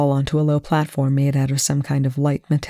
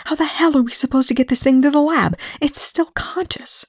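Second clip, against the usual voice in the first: high, fast protest, dropping to a whisper; exasperated hiss; 11 semitones higher than usual. A narrator's reading. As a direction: protest urgently in a raised pitch. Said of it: "Eli hissed".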